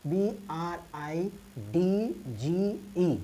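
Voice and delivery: slow, letter by letter; spelling out. Shown as a demonstration. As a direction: spell it slowly for the class. Spelling out the example word. (एक-एक अक्षर धीरे-धीरे बोलते हुए)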